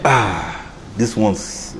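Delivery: low, soft admiring sigh; hushed admiration. Retold or imitated. Seeing [name]'s photo, a low soft sigh of awe. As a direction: sigh in quiet wonder.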